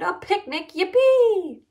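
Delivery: high, gleeful class cheer; whooping with delight; excited shouting. A narrator's reading. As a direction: cheer it high and loud, stretching the last word. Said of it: "everyone shouted".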